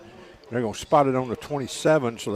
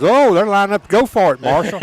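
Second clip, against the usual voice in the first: higher, louder burst of surprise; excited alert; 7 semitones higher, 8 dB louder than usual. Real, not acted. Co-host tells announcer they are going for it, excited. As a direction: alert your partner to the sudden gamble. Co-host spots fourth-down gamble.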